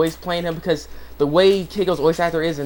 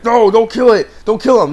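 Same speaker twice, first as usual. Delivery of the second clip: pleading shout; 7 semitones higher, 9 dB louder than usual. Retold or imitated. Old man stops the kill.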